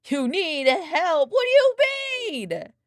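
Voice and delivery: high, loud, slow you need help; mock-tough loud question; mock challenge. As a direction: call out the cat playfully.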